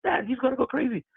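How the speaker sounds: high squealing imitation of his daughter; imitating daughter. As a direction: pitch way up to play the excited daughter. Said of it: "She goes".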